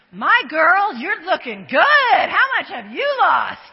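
loud, gushing praise for a friend; hammed-up girlfriend squeal over weight loss; a friend gushing over weight loss, loud and effusive; gushing compliment.

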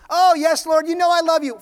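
high, loud profession; emphatic assurance. Acted. Peter assures Jesus of his love, emphatically. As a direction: answer at once, at full voice.

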